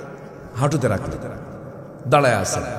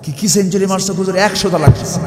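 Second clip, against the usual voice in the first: acted astonishment, higher and louder; raised, incredulous retelling; startled echo; 5 semitones higher, 6 dB louder than usual. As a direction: echo it back in mock disbelief. Cleric echoes the caller's report, startled.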